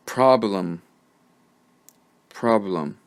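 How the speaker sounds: "Problem" said alone as PROB-luhm; careful word model. Shown as a demonstration. Teacher saying the word so learners find the schwa, careful model. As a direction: dictate "Problem" with a reduced ending, then repeat. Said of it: "I'm going to say the word".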